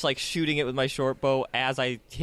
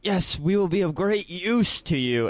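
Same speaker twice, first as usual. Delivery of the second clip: sly heroic promise; 6 semitones higher, 3 dB louder than usual. Acted. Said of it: "look at Amir and go".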